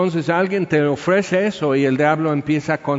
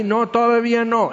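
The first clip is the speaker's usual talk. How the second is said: voz del lobo; 7 semitones higher than usual. Acted.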